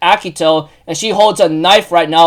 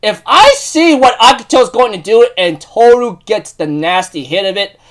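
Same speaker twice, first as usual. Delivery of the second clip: ordinary delivery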